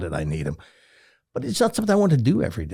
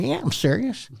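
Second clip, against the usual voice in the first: put-on hillbilly accent; 11 semitones higher than usual. Student answering his doubting teacher, hillbilly accent. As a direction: imitate an earnest young hillbilly. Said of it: "you talk like this".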